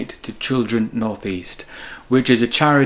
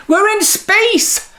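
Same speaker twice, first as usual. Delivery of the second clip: much higher, slow, drawn-out shout of wonder; excited yell; 5 dB louder, much slower than usual. Acted. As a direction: cry it out like a kid floating in space. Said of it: "Danny yelled".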